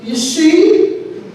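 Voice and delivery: high, loud I-told-you-so; vindicated mother. Retold or imitated. Mother after the duck poops on the floor, vindicated. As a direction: imitate mom scoring her point.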